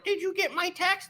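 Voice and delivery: very high, small mock voice; needy texter. Coach mimicking someone chasing a reply, high needy voice. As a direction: squeak it softly, pestering.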